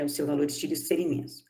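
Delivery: quick quiet repeat. Shown as a demonstration. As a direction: rattle the repeat off quickly and softly. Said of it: "lecturer repeats point to stress it".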